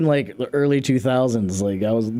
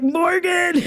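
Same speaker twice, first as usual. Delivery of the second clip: calling roommate. Retold or imitated. Shouting for roommate Morgan about a bat, laughing panic. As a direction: yell for the roommate, half laughing.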